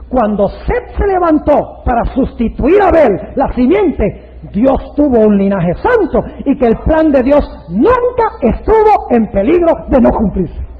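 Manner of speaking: loud, slow, weighty preaching; drawn-out, emphatic preacher's climax; climactic preaching. Real, not acted. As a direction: land each phrase with full force. Preaching climax at full force.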